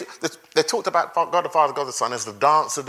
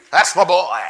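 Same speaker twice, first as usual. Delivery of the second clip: casual mock quote; 6 dB louder than usual. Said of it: "You see?".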